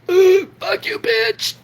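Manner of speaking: very high, strained gasp of defiance; winded defiance. Retold or imitated. Kicked kid on the ground gasping defiance at bullies. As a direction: force it out while being kicked. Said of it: "getting kicked in the stomach".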